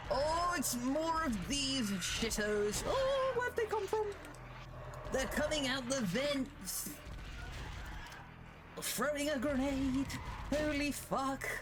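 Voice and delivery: high, drawn-out alarm of a British gentleman; hammed-up flustered officer under attack; mock panic. Officer character swarmed by enemies.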